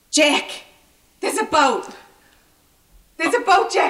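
high, loud desperate cries; tragic heroine sorrow. Acted. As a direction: shout for Jack across the water. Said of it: "let me get in character".

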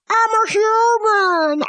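high, drawn-out mock human voice; stilted "I am human" put-on; pretending to be human. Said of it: "If I was pretending to be human, I'd be like".

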